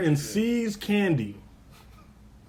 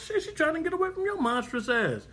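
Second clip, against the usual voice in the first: mock high outrage; 13 semitones higher, 3 dB quieter than usual. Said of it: "I said".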